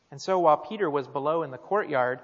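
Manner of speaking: usual speaking voice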